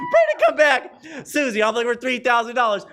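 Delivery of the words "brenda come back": laughing plea. Real, not acted.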